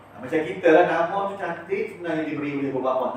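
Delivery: normal talking voice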